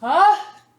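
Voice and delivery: loud, high acted sneeze; big sneeze. Teacher acting the bear's sneeze for the class, loud mock sneeze. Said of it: "He blows and he sneezes".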